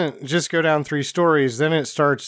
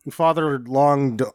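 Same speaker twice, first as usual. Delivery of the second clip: ordinary delivery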